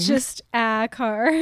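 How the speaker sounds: slow, with "a" drawn out and emphasised; stress on "a". Real, not acted. Cohost on a car left unnamed, stressing "a". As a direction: lean on "a", stretching it as its own word.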